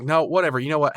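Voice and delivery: retold brush-off, hammed up a little; mock angry Mordecai.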